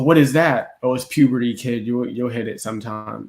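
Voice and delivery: ordinary talk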